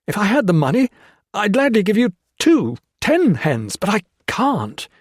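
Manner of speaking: high, loud desperate plea. Acted. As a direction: beg in desperation. Hawkins pleads desperately.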